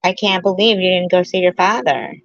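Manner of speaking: normal talking voice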